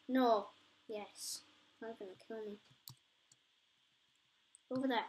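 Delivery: normal talking voice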